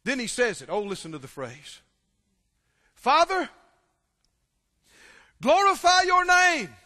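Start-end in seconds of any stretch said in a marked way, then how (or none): 2.9-6.7 high, loud cry to the Father; loud fervent prayer. Acted. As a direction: lift it up as a ringing prayer. Jesus' prayer, voiced aloud.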